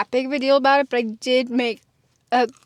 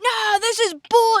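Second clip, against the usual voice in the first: angry protest; 9 semitones higher, slower than usual. Retold or imitated. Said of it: "so pissed".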